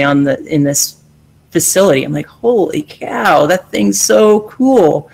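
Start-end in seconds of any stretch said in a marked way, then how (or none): 2.3-5.1 slow, higher, drawn-out amazement; retold awe. Recalling seeing the rocket in the facility, slow awed wonder. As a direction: stretch it out like a kid seeing a rocket.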